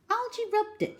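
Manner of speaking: ordinary delivery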